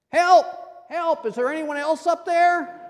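very high, very loud frantic cries; desperate man refusing God's plan, screaming; yelling for help. Acted. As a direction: scream up the cliff for anyone else. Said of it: "Finally, Jack yelled".

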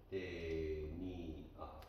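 テニア said very slowly, te-ni-a split into three syllables with stress on ニ; syllable demo. Shown as a demonstration. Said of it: "三音節になるのね".

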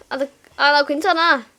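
usual speaking voice

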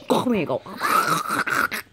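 slow, breathy whispered brag; whispered slow boast; hoarse whisper. Shown as a demonstration.

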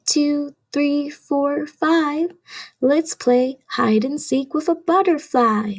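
usual speaking voice

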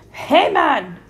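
loud, high troll shout; roared challenge. A narrator's reading. Troll catching a goat on his bridge, roaring. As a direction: roar it at the trespasser. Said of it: "roared Calypso Joe".

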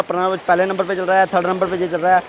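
ordinary talk, nothing marked

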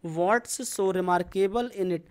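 each word slow and clear for the class; slow repeat-after-me. Shown as a demonstration. Teacher reading slowly for students to repeat. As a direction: spell it out slowly so they can copy. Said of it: "चलिए मेरे साथ बोलिए".

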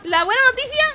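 laugh-crying. Real, not acted. Boss still alive, laughing.

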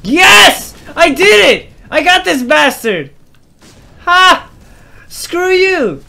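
high, loud victory shouts; triumphant yelling. Real, not acted. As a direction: crow over the beaten enemy. Wins the fight.

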